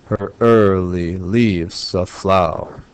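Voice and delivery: slow beats on "ear", "leaves" and "flower"; iambic scansion. Shown as a demonstration. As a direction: read "Her early leaves a flower" as three slow iambs. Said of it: "I'm looking for three iambs per measure".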